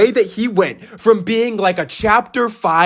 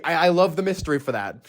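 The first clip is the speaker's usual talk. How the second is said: normal talking voice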